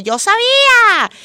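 high, loud, slowed-down yell; neighbour yelling. Retold or imitated. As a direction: shout it like the half-deaf neighbour upstairs. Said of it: "oigo a la vecina y dice".

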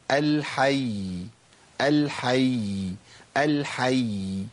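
each الحي very slow, its final y held; drawn-out endings on every الحي; lengthened final ya. Shown as a demonstration. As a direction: repeat الحي, sustaining the doubled y at each stop. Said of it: "أزود زمن شوية بس".

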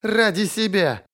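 high, drawn-out scoff; scornful snort. A narrator's reading. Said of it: "Она фыркнула".

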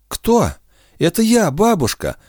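high startled exchange; surprised. A narrator's reading. Grandmother startled in the dark, high surprised voices. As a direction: pitch it up in surprise.

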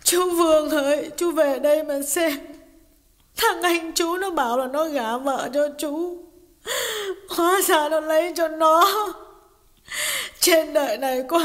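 high female voice calling out, lightly crying; a touch of weeping wife calling out; tearful appeal. A narrator's reading. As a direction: wail to the absent brother-in-law. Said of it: "tiếng khóc náo nuột của Hà".

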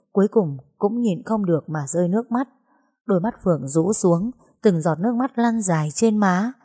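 ordinary delivery, nothing marked

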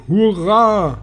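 high, drawn-out cheer; cheering cry. A narrator's reading. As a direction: let the cheer swoop up high. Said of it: "schrien die anderen". (jubelnder, hoher Ausruf)

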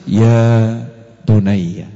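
Ya bunayya very slow and low; tender call. Shown as a demonstration. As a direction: call to a beloved son. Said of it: "manggil anak sing halus". (panggilan lembut dan pelan)

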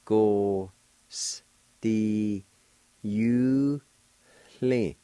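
"Go, s, d, u, li" with a faint H breathed before the "li"; Cherokee with faint H. Shown as a demonstration. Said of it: "there's a faint H sound, like this".

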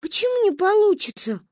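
put-on high-pitched, hurt child's question; wounded protest, pitched high; offended. A narrator's reading. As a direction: take offence in a high little voice. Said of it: "обиделась Манька".

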